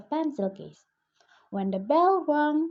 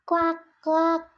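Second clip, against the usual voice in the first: playful duck quack; 6 semitones higher than usual. Acted. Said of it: "and they both laughed".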